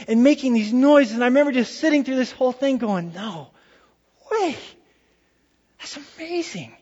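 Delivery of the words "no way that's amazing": soft, stunned amazement; hushed awe. Retold or imitated. Preacher recalls sitting through the choir's singing, hushed awe. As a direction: say it as if awestruck, barely aloud. Retells being awed by choir.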